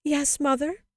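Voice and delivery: put-on high, loud childlike plea; bitterly sobbed. A narrator's reading. Child answers shocked mother, sobbing bitterly. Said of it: "the child sobbed more and more bitterly".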